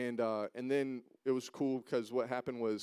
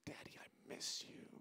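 soft whisper, barely voiced; 15 dB quieter than usual. Little girl whispering to her dad that she misses him. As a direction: hush it like a small child's confession. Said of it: "She goes".

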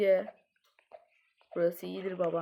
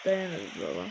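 kalın ses; 13 semitones lower, 6 dB quieter than usual. Pretend. Deep tough-guy voice.

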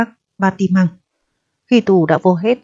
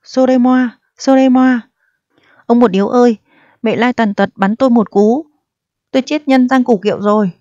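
higher, hammed-up mock cry for help; hammed-up wailing; 5 semitones higher than usual. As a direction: wail like someone being killed, in jest. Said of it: "miệng la như người bị cắt cổ".

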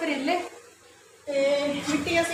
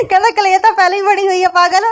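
laughing; 4 semitones higher, 8 dB louder than usual. Retold or imitated. Mother laughing retelling kid's words.

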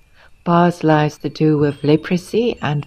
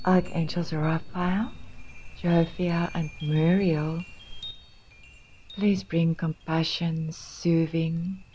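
hushed, much softer healing invocation; soft prayer; 10 dB quieter than usual. Acted. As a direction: pray it quietly, pausing between phrases. Softly repeats the closing healing prayer.

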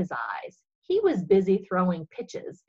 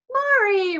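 high, loud happy call; joyful cry; 13 semitones higher, 7 dB louder than usual. Acted. Girl greeting her little angel, high and joyful. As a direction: call out her name with relief.

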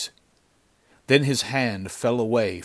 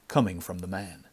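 ordinary delivery, nothing marked

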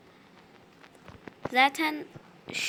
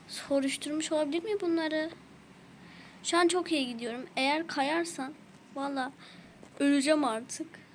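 ordinary delivery

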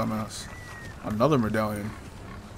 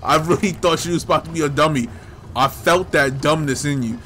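loud, raised-pitch ribbing of a game character; exasperated mock telling-off; mock scolding; 3 semitones higher, 4 dB louder than usual. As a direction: scold Leon loudly, only half serious.

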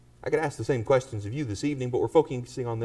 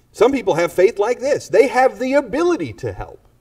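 fervent preaching; 6 semitones higher, 6 dB louder than usual. Acted. Preaching at full force.

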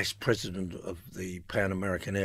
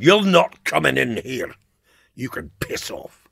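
imitated Scottish refusal, starting loud and raised; thick Scottish accent; 4 semitones higher, 8 dB louder than usual. Poet barring the storyteller from his house, thick Scottish accent. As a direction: put on a thick Scottish accent to turn someone away. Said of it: "he said in his thick Scottish accent".

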